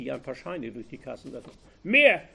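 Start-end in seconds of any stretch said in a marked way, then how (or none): 1.8-2.2 one-word scream, higher and much louder. A narrator's reading. Bank robber demanding more money, screaming. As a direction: yell it at the cashier. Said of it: "schrie der Bankräuber".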